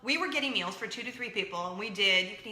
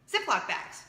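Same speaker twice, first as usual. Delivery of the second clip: normal talking voice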